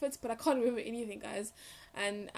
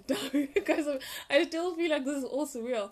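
giggling through the words, a bit louder; laughing; 4 semitones higher, 6 dB louder than usual. Real, not acted. Vlogger telling viewers the interview felt surreal, laughing. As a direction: laugh it out in disbelief.

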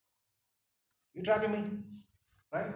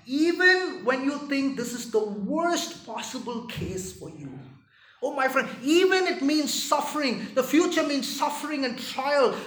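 higher, loud, pleading; fervent preaching; 9 semitones higher, 11 dB louder than usual. Real, not acted. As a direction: lift them up through suffering. Second preaching climax.

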